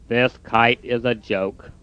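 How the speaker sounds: low, soft, slow defeated voice. Acted. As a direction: drag out the giving up. Toad's voice.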